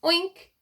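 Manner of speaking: a sad pig's wail; mournful oink. Acted. The pig Mister Grumble wailing a mournful oink. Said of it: "wailed Mister Grumble mournfully".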